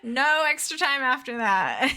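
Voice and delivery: laughing. Shown as a demonstration. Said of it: "laughing repeat".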